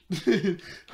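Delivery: short, loud, high laugh; quick high cackle. Real, not acted. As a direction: burst out laughing. Laughing at the belly flop.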